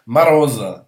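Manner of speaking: loud "Maroz" with an a in the first syllable; Russian reduced O. Shown as a demonstration. Teacher repeats the example word, emphatic Russian pronunciation. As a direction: punch out "Maroz", first vowel a. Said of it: "en russe on prononcera maroz".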